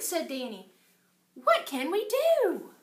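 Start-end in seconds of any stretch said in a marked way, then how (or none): 1.4-2.7 higher, louder, slow and eager; eager boy. Acted. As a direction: ask like an excited kid. Danny voiced excitedly.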